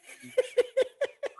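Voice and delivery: high burst of laughter. Real, not acted. Laughing at the memory.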